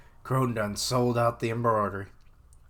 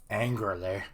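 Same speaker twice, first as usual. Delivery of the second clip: ordinary delivery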